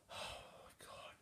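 slow, barely audible whisper; exasperated whisper. Acted. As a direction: breathe it out, fed up. Venting about stupid friend.

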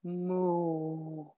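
low, soft moo; cow moo. Shown as a demonstration. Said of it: "And a cow".

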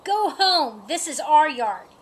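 loud storybook scolding, starting high; cross cat. A narrator's reading. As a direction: order them off your yard. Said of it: "hissed the calico".

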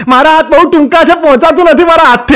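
high-pitched mock pleading; helpless wife. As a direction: imitate a wife complaining she can't manage. Wife pleads short arms.

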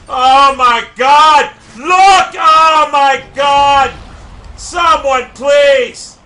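slow, loud, dragged-out pleading yell; desperate furious shout. Real, not acted. Gamer begging teammates to shoot down a helicopter, desperate shout. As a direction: beg the team in full rage.

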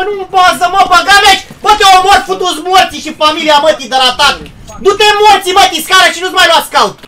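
high, loud furious cursing; raging shout. Real, not acted. Gamer raging at a teammate who wandered off. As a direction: let the anger explode.

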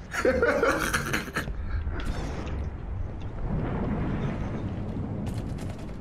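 high wheezing laugh; breathless laugh. Real, not acted. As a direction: silent wheezing giggle. After friend's outburst.

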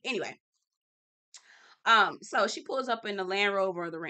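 usual speaking voice

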